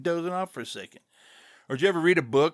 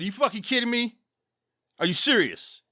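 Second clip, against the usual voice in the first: incredulous retort; 6 semitones higher than usual. Retold or imitated. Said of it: "And I looked at him, I said".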